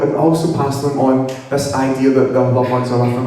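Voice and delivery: ordinary talk, nothing marked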